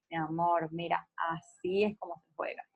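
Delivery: put-on soft and patient, showing a toddler how to play; gentle modeling voice. Shown as a demonstration. Teacher models how a parent tells a small child how to share, softly and gently. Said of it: "y le vas modelando".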